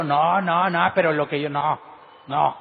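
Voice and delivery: loud, insistent mock protest; defensive denial. Preacher mimics someone veiling their mistake, defensive denial. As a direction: act out someone refusing to admit a mistake.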